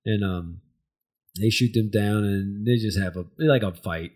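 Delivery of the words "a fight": ordinary talk, nothing marked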